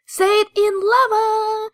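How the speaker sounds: playful slogan. Pretend. Reading the site's slogan playfully.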